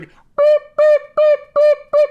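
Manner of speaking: high, loud electronic beeping, far above the speaker's own voice; mock alert beeps. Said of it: "it was like".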